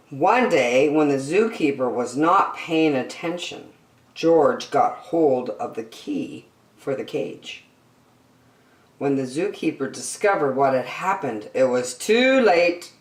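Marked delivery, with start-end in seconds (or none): none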